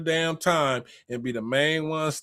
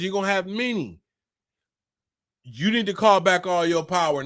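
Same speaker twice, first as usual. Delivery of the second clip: ordinary talk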